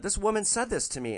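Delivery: ordinary talk, nothing marked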